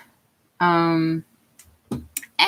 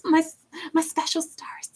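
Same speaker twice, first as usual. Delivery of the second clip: high, hushed stage whisper; soft, teasing whisper; playful whisper; 11 semitones higher, 6 dB quieter than usual. Pretend. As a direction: whisper it like a precious pet name. Playfully naming viewers her stars.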